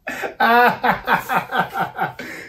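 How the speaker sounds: cracking up at the line; real laugh. Real, not acted. Reader laughs at text.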